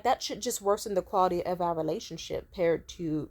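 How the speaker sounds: usual speaking voice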